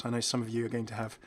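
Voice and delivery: ordinary talk, nothing marked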